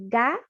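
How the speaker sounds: "gato" in two separate syllables, pause between; split into syllables. Shown as a demonstration. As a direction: break "gato" apart as ga, then to. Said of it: "Sílaba, por exemplo". ("gato" separado em sílabas, com pausa)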